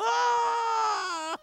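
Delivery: long high wailing scream; screaming while falling down the wall. Retold or imitated. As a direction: wail it out, very high and loud. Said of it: "he was screaming".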